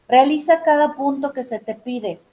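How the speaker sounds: usual speaking voice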